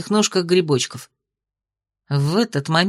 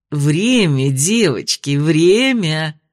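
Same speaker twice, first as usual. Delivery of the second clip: stern teacher voice; 3 semitones lower, much slower than usual. A narrator's reading. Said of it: "раздался в воздухе голос мисс Роуз".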